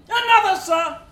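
loud, high call for another drink. Acted. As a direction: bellow for the next bottle.

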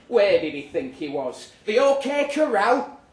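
mock him with disbelief; scornful rant. Diarist scoffing at Jolene's spurred boots, loud and high.

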